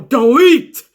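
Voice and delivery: loud, high battle cry of defiance; defiant shout. Retold or imitated. Hardy on his knees shouting at Wyatt, defiant shout. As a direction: yell it right in his face.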